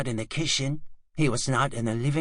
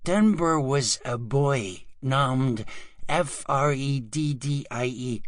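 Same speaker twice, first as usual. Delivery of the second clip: ordinary delivery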